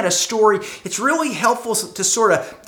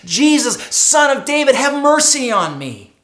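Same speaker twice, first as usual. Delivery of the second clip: calling out louder, pleading; crying out; 4 semitones higher, 4 dB louder than usual. Acted. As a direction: cry out to Jesus for mercy. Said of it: "begins to cry out to Jesus".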